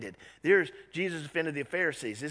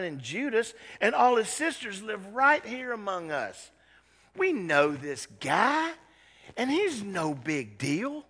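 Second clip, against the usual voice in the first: scoffing; 5 semitones higher, 5 dB louder than usual. Retold or imitated. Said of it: "They scoffed".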